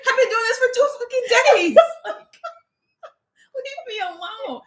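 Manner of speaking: very high, laughing fake wail; mock tearful protest. Creator begs commenters for patience, hammed-up tearful wail. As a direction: wail it in comic exasperation.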